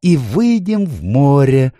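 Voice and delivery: high, drawn-out triumphant finish; triumphant faun. A narrator's reading. Faun finishing his plan before dancing, triumphant. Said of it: "закончил Фавн".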